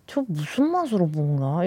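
low, hushed grumble to oneself; soft, sceptical inner voice; muttered puzzlement. Retold or imitated. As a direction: mutter it under your breath. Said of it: "이러고, 이러고".

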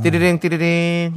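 mimicked phone ring; phone ringing noise. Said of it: "phone ring imitation".

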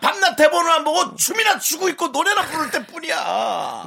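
shouting in playful anger; mock angry shout. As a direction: raise your voice, half-joking fury. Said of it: "지금 원성 높아지지 마세요".

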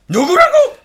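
loud, high rallying shout; shouted call. Acted. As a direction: bark it at the troops. Drill leader calls to the crew.

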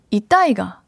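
Busan-dialect practice of 있다 아이가; Busan accent. Shown as a demonstration. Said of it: "부산은 두 번째 음을 올리잖아요". (부산 사투리 억양으로 "있다, 아이가")

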